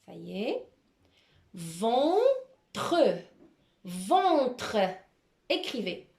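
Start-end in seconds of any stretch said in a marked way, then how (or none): 1.5-3.0 Ventre drawn out, with a gap before tre; slow dictation of Ventre in two parts; split into syllables. Shown as a demonstration. Teacher dictates a word by syllables. 3.8-4.8 Ventre in one piece, first vowel held; carefully sounded Ventre, syllables linked; joined-up dictation. Shown as a demonstration. Same dictated word said joined.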